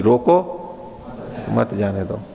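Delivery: corrected phrasing, split straight after "रोको"; corrected pause after रोको. Shown as a demonstration. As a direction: break after रोको, run मत into जाने दो. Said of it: "नहीं".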